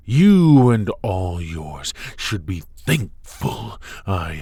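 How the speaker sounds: imperious angry warning, drawn out; menacing outburst. Acted. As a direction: lash out, stretching "You" high and loud. Villain threatens opponent and their people.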